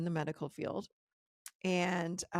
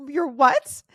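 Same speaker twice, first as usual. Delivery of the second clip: shocked disbelief; 7 semitones higher, 14 dB louder than usual. Retold or imitated. Shocked reply to dentist.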